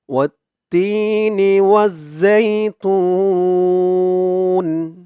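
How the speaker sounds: slow melodic recitation; Quran recitation. Acted. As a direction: chant the verses, drawing vowels. Recites the verses. (ধীর সুরেলা তিলাওয়াত)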